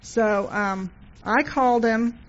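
usual speaking voice

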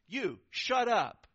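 high, loud, slow rebuke; stern shout. Acted. Pastor answers an interruption mid-service, stern shout. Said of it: "my response was".

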